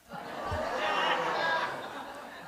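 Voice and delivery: breathy airy laugh, high; laughing at his own pun; long, high, real laugh at his own pun. Real, not acted. Laughs at own Polish joke.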